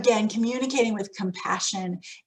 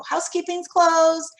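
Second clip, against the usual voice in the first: mock announcement; 7 semitones higher, 5 dB louder than usual. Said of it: "people go".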